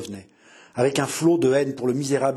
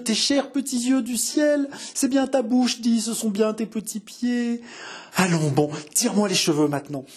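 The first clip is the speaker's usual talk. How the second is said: higher, tender delirious joy, voice rising; passionate mother; 7 semitones higher than usual. A narrator's reading. Mother weeps with joy over her found daughter, high and passionate. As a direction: smother the child in words of love. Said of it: "murmurait passionnément".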